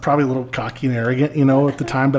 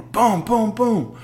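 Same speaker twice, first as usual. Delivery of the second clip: high, punchy booms; three pounding knocks voiced as booms; imitated door pounding; 9 semitones higher, much slower than usual. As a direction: mimic a fist pounding the door. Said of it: "I hear the door".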